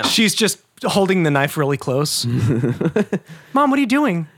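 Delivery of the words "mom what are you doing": higher, mock-worried kid voice; a child nervously asking Mom; alarmed kid. Voicing the Brady kid worried about Mom's knife, higher alarmed voice.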